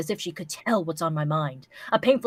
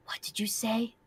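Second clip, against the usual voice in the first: whispered threat, leaning in; hiss-free hushed menace; menacing whisper; 3 semitones higher, 9 dB quieter than usual. Acted. Menacing question in reading.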